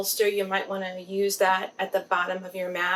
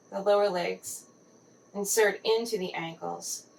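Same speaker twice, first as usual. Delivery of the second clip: guided meditation. Acted. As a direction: gently guide the listener into relaxed body awareness.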